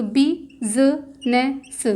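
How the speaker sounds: बी ज न स broken into slow separate sounds; sounding out slowly. Shown as a demonstration. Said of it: "बी का sound आएगा". (धीरे-धीरे अक्षर अलग करके)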